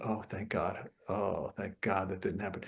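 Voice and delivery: a quiet sigh of relief to himself; relieved inner thought. Retold or imitated. Musician thinking with relief at the break, quiet murmur. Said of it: "I'm thinking".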